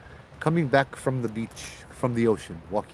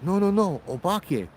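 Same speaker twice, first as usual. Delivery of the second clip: insistent fear; 8 semitones higher than usual. Retold or imitated. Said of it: "And she's like".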